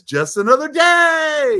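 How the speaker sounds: high, loud, drawn-out cheer; upbeat demo. Shown as a demonstration. Showing how a woman on a tough day should say it, upbeat and high. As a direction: sing it out high and loud, stretching the words. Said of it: "it should be like this".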